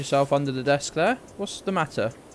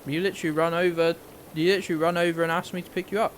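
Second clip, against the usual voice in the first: fast chiding; 3 semitones higher, faster than usual. Real, not acted. Chiding the dog quickly.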